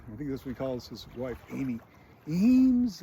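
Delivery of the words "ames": louder, high-pitched call. Retold or imitated. Husband calling his wife who doesn't answer, louder imitated call.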